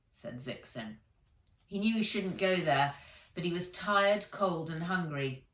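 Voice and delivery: usual speaking voice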